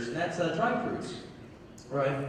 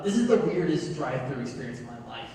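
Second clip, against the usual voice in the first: ordinary talk, nothing marked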